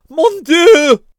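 high, loud horrified scream. Acted. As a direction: shriek it in horror. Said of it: "screamed Barreau".

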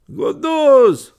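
high, loud street cry; shouting. A narrator's reading. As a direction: holler it across a boulevard. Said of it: "a man shouted over the Boulevard".

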